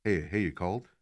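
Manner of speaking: low, furtive murmur, imitating a caller; mock-secretive, soft and low; imitated hushed phone voice. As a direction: keep it down as if overheard. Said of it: "Why are you whispering?".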